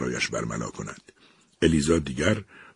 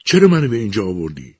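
higher, louder imploring question; pleading; 6 semitones higher, 7 dB louder than usual. Acted. Eliza implores the machine, higher and louder. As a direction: beg for an answer. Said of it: "با التماس پرسید".

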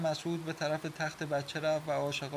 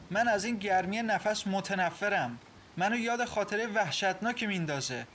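higher-pitched, recoiling in disgust; 6 semitones higher, 4 dB louder than usual. A narrator's reading. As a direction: push him away with revulsion. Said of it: "با انزجار گفت". (با انزجار)

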